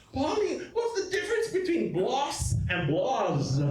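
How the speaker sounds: high, excited mock question with a laugh; hammed-up worried voice; mock anxious question.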